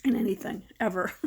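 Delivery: usual speaking voice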